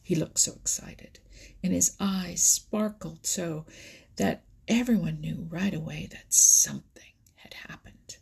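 ordinary delivery

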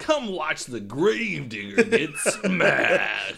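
slow, shouted announcer hype; monster truck ad. Acted. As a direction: bellow it like an over-the-top promo.

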